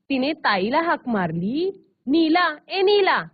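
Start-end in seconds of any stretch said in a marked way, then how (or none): 2.1-3.2 loud, drawn-out call across the house. Acted. Mother calling her daughter Nila from afar, loud and drawn out. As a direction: call her name from another room. Mother calls out to older sister.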